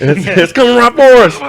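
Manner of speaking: high, loud cartoon panic; South Park voice. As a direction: squeal it like a cartoon hunter. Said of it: "South Park".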